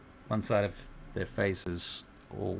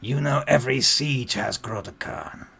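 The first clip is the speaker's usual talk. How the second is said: loud, raised orc voice in broken speech; orc child, demanding; 4 semitones higher, 8 dB louder than usual. Acted. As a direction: demand answers like a wary little orc.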